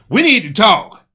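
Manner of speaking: loud, high-pitched confrontational outburst; sudden mock shout; abrupt demand. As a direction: blurt it out loudly, as if ambushing a tired partner. Mock demand on a tired partner.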